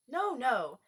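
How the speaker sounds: brushing the question aside impatiently. A narrator's reading. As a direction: cut them off, you want the adventures now. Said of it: "in an impatient tone".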